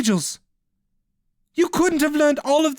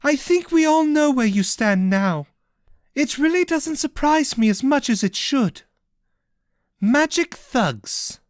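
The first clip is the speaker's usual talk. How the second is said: higher, scornful retort, slowing on the insult; sharp snap; 13 semitones higher than usual. Acted. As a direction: snap back with contempt. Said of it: "retorted in a sharp snap".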